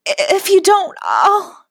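high, loud stammered warning; faltering high-pitched threat; unfinished warning. Acted. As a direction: stammer high, then break off. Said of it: "I ended my sentence, not wanting to finish it".

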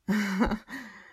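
short mocking laugh; teasing laugh.

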